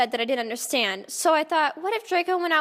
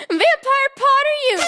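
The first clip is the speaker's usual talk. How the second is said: much higher, louder melodramatic yell; hammed-up yell; 13 semitones higher, 8 dB louder than usual. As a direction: scream the name across the classroom. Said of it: "I yelled".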